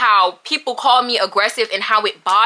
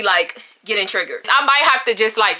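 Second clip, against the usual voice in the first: usual speaking voice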